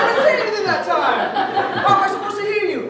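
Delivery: high, loud, laughing exasperation; exasperated yelling. Retold or imitated. Boyfriend to whispering girlfriend in the theater, laughing loud exasperation. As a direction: throw your hands up at her, loud.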